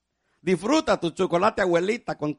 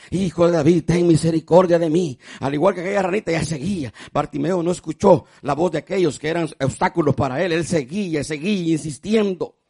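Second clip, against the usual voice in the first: a sermon shouted from the pulpit; preaching at full force; 10 dB louder than usual. Acted. Preaching again to congregation.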